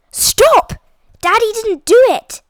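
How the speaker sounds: very high, loud desperate cry; desperate shout. A narrator's reading. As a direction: scream to stop them. Daisy defending her father.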